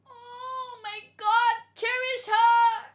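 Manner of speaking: high, loud, slow tearful plea; crying plea. Real, not acted. Reactor pleading through tears over the brave child.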